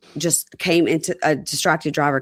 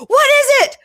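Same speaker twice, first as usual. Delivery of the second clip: very high, loud mock panic; panicked; 8 dB louder than usual. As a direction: yelp the question in exaggerated alarm. Acting out his panicked question.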